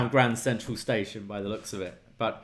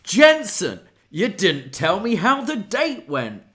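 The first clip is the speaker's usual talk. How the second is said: higher, louder buddy voice; mimicking Briggs; 8 semitones higher, 7 dB louder than usual. Host imitating Briggs quizzing Jensen at the bar, higher and louder. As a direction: play the bar-buddy asking about the date. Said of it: "And Briggs goes".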